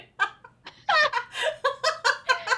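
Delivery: loud, high cackle; cackling. Real, not acted. Streamer cracking up at friend leaving for her chat, cackling. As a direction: burst into a real, high cackling laugh. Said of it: "I'm cackling again".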